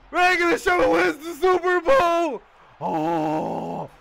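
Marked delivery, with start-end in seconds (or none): 0.1-2.4 triumphant shout. Real, not acted. Victory announcement.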